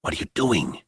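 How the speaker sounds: hushed, breathy question; a worried whisper to the general. A narrator's reading. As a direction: whisper it so nobody else hears. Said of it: "Nick whispered".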